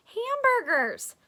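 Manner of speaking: high, loud, drawn-out cry; excited exclamation. Acted. Sophie smelling lunch, excited. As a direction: sing out the word with delight.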